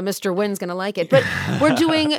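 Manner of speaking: ordinary talk, nothing marked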